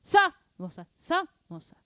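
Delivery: rhythmic chant with the first Sa jumping out; loud accent on Sa. Shown as a demonstration. Drum teacher demonstrating volume accents, Sa punched loud. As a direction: punch Sa high and loud, drop back on mo, sa.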